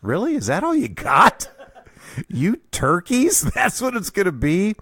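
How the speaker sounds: high, laughing mock scorn; mocking disbelief. Kid silently mocking his dad's weak insult, laughing scorn. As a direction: tease him as an unimpressed kid, giggling. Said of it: "I thought".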